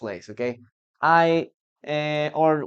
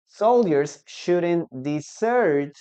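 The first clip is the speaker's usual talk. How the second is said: slow, careful teacher read; slow example sentence; slower than usual. Shown as a demonstration. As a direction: read the sentence slowly for students. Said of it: "On the other hand".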